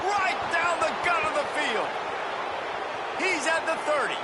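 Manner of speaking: high, excited call building to it; touchdown call. Real, not acted. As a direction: sell the score big.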